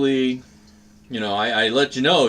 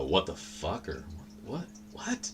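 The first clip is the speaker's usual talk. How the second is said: muttered disbelief; 3 semitones lower, 13 dB quieter than usual. Retold or imitated. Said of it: "You can hear it when I".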